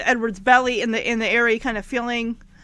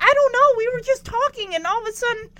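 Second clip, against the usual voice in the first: put-on high, raised voice of a rattled kid; hint of a worried boy, pitched up; lightly voiced upset boy; 10 semitones higher, faster than usual. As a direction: imitate a flustered son explaining. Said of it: "And Ricky's like".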